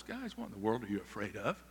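soft, gentle scolding; a quiet, mild rebuke. Acted. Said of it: "It was a mild rebuke".

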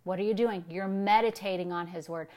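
ordinary talk, nothing marked

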